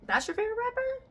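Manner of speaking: very high disbelieving question; high-pitched mock disbelief; incredulous. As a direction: squeak it out in shock. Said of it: "So I was like".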